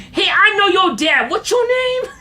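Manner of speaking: high, excited imitation of the man; bus passenger. Man taps new passenger's shoulder, high loud greeting. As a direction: mimic a man hollering at a stranger. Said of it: "he taps on his shoulder".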